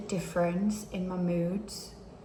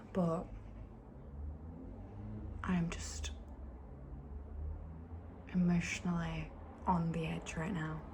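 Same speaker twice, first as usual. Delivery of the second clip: soft, fragile whisper; real tearful whisper; 4 semitones lower, 8 dB quieter than usual. Real, not acted. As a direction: whisper it as if about to cry. Says she's emotionally on edge.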